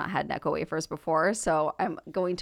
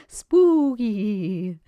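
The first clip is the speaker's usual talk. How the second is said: spooky voice; 3 semitones higher, 5 dB louder than usual. Shown as a demonstration. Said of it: "your spooky voice".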